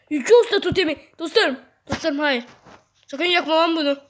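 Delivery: loud, high urgent shouting; alarmed warning. Real, not acted. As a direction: yell a frantic warning to friends. Alarmed warning to teammates in game. (telaşla bağırarak uyarı)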